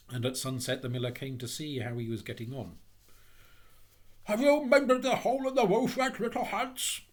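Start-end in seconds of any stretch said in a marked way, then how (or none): 4.2-7.0 loud, high, hearty hail; cheery call. A narrator's reading. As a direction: call out cheerily to someone up a ladder. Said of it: "cried the miller in a cheery voice".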